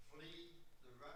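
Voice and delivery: far-off, faint call; distant yell. Shown as a demonstration. Congregant yells Wesley's catchphrase on request, distant and faint. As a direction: call it out from across the room, barely picked up. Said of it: "probably a little louder".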